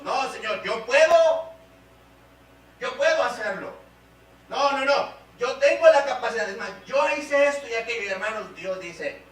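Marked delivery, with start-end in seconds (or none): 0.0-8.0 louder, higher insistence on going it alone; acted self-reliant refusal, forceful and raised; defiant protest. Preacher voicing a believer who won't depend on God, defiant.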